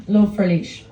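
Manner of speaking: ordinary talk, nothing marked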